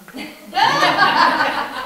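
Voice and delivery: big high burst of laughter; hearty laugh. Real, not acted. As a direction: laugh out loud at the joke. Laughs at own joke.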